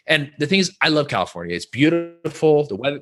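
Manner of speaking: usual speaking voice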